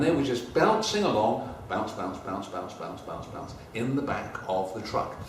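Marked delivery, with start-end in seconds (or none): 1.7-3.5 each bounce softer until almost gone; rhythmic bounces fading quieter. A narrator's reading. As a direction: let the bounces die away. Caps bouncing in the truck.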